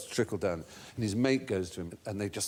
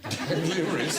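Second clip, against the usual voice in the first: amused disbelief; 5 semitones higher, slower than usual. Real, not acted. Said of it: "repeats answer amused".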